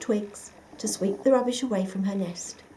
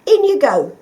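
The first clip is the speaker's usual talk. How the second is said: firm; 6 semitones higher, 12 dB louder than usual. A narrator's reading. Badger dumping rubbish.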